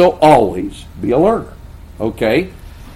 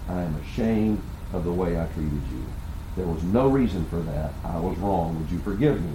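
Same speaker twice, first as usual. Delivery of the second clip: low, soft confession; retold humble apology; 8 semitones lower, 10 dB quieter than usual. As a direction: replay a contrite apology, quiet and deep in pitch. Retold humble apology to his wife.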